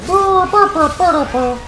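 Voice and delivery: loud, high burbling sound effects; playful mouth-made battle noises; imitated spell noises. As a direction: fire off high vocal blasts like a game spell. Imitating spell noises after casting.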